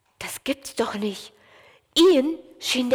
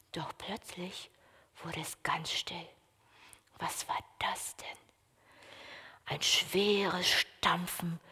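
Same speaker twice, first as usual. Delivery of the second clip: soft, breathy suspense whisper; hushed whisper; 4 semitones lower, 11 dB quieter than usual. A narrator's reading. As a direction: hush them as the stomping nears. Sudden hush, stomping approaches.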